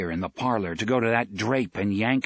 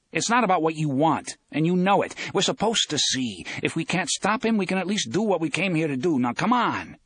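insistent; 6 semitones higher than usual. A narrator's reading. Eddie argues with reluctant Jake.

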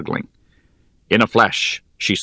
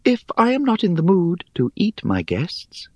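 high, airy, teasing spider; airy little voice; 10 semitones higher than usual. Acted. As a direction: toss it off lightly, amused. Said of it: "she continued in her airy little voice".

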